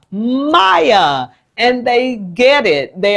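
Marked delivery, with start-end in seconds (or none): 0.1-1.3 "Maya" stretched out, high then falling; exaggerated two syllables. Shown as a demonstration. Teacher repeating the name to class, exaggerated "Maya". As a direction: draw out "Maya", starting high and sliding down. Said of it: "your name has two syllables".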